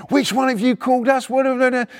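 high, urgent mock shout; shouted questions. Copper went ballistic, questioning neighbour, shouting. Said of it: "Shout at him".

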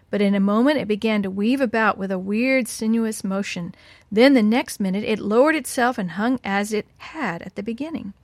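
ordinary delivery

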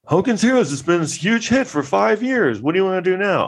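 high, hammed-up exec voice; mock exec. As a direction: parody a clunky biopic line. Biopic character quoted mockingly.

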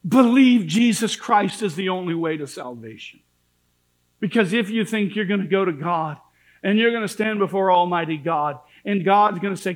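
ordinary talk, nothing marked

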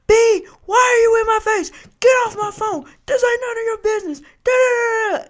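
very high, loud mock tirade; sudden yelling. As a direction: blow up at her, trailing into angry gibberish. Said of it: "goes from whispering, like ... to".

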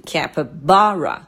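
capybara with BA highest; teacher's demo of capybara, peak on BA; stress on third syllable. Shown as a demonstration. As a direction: lift and lengthen BA in capybara. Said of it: "最高音的音节是在ba".

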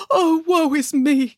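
high, drawn-out mock-tragic wail; wailing lament. A narrator's reading. Mother pig learns of her children's fate, wailing lament. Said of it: "cried the mother".

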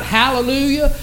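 mildly shouted hallelujah, swelling as it goes; retold joyful shout. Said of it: "she began to shout".

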